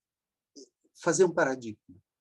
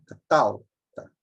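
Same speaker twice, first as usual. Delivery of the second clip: Catalta slow, stretching middle TAL; low, drawn-out Catalta showing the strong middle syllable; penultimate stress; 4 semitones lower, 4 dB louder, much slower than usual. Shown as a demonstration. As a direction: linger on TAL in Catalta, drop the final ta. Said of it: "Catalta é paroxítona".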